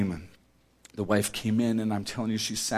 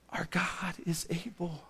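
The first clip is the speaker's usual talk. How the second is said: near-whispered, much softer preacher's line; hushed declaration; 6 semitones higher, 9 dB quieter than usual. Real, not acted. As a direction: drop to a breath of reverent assurance. Hushed close after the climax.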